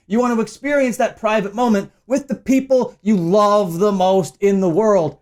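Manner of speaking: ordinary talk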